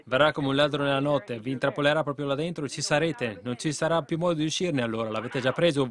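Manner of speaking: normal talking voice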